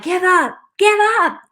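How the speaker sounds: high, rising plea to quit; inner voice pleading. Retold or imitated. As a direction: beg in a squeaky-high inner voice. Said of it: "The other half of me was like".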